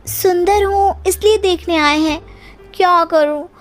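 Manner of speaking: high, preening bride voice, pleased with herself; vain young bride. Acted. Bride to mother-in-law, preening and high. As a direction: act the spoilt pretty bride who knows her looks.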